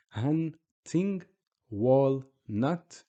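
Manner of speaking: "hunting, walnut" slowly split after N and L; stops at sukoon. Shown as a demonstration. Teacher recapping English examples, split syllables. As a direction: pause mid-word in "hunting, walnut". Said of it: "You can see where the sukoon is".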